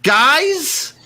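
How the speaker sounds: high, shaky voice, relieved and babbling; a survivor thanking the posse; frantic survivor. Acted. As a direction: gush with relief at being rescued. Same NPC voice.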